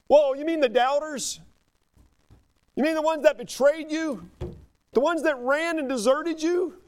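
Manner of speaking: high, astonished questions; amazed disbelief. Real, not acted. Preacher marvelling at grace toward deserters, astonished.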